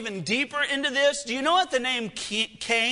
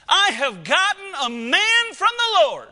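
Eve's joyful cry, higher and louder; excited mother; 7 semitones higher, 5 dB louder than usual. Acted. Eve holding her firstborn, excited proclamation. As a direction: proclaim the birth with delight. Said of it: "here is his mama's reaction".

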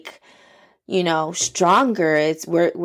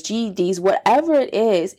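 usual speaking voice

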